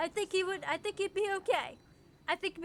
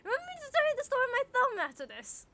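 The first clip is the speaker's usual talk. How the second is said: excited rush; 8 semitones higher, 3 dB louder, faster than usual. Real, not acted. Repeats reminder excitedly.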